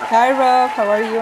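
ordinary talk

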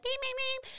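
a feeble car horn that scares nobody; weak little horn. Retold or imitated. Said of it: "does not sound as aggressive as I want to".

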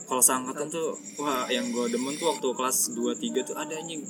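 mumbling away from the mic; too quiet. Real, not acted. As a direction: speak too softly to be heard. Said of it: "Kencengan dikit apa ngomong lu".

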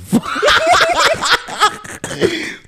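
high shrieking laugh; loud burst of laughter. Real, not acted. Joke about OnlyFans income.

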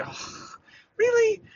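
breathy oh, then really jumps way up high; a hammed-up stunned really?; mock disbelief. Replaying his reaction to criticism, mock disbelief.

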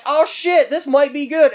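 mock excitement. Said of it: "you're like".

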